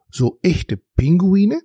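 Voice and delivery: slow, incredulous question with a high rising end; astonished. A narrator's reading. Dragon checking whether they were real penguins, astonished. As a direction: draw it out in wondering disbelief. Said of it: "fragte Turmalin erstaunt".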